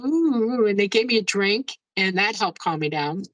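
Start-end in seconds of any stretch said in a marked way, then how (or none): none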